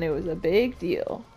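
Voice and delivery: normal talking voice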